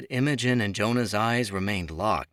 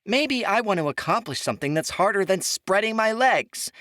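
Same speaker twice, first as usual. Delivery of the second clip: much higher and louder, a cruel shout; yelling; 13 semitones higher, 5 dB louder than usual. A narrator's reading. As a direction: yell it to wound. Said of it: "Imogen yelled".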